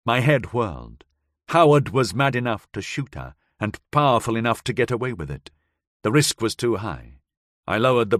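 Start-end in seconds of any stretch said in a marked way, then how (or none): none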